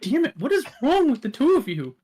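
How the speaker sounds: very high, loud character outburst; exasperated Death. Acted. Death fed up with the two of them, loud high exasperated voice. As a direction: cry out in high-pitched exasperation. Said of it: "you just hear the voice like".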